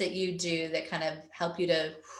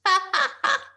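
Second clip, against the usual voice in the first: high, loud burst of laughter; laughing at her own screen-share mistake; real laugh; 12 semitones higher, 5 dB louder than usual. Real, not acted.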